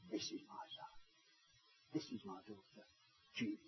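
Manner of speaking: hushed, tender whisper; soft whispered blessing; whispered affirmation. Retold or imitated. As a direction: whisper it like a loving dad. Imagined dad affirming child.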